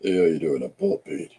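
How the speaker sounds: badly done impression, introducing himself; low greeting in a put-on voice; deliberately poor impression of a man saying hello; Paul Pate. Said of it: "worst impression of Paul Pate".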